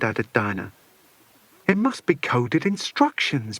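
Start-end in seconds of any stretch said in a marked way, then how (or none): none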